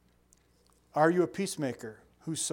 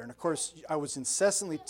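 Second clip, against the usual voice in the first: ordinary talk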